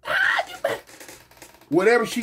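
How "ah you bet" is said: high screeching woman voice; screeching sister. Retold or imitated. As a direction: shriek it like the angry sister. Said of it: "She started hollering".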